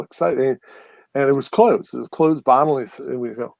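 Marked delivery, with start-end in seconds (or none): none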